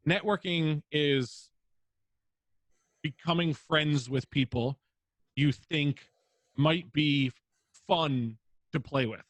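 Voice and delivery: slow, spaced-out emphatic phrasing; slow emphasis. Real, not acted. Advising new streamers, slow emphatic definition. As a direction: lay each word down deliberately. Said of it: "defining networking".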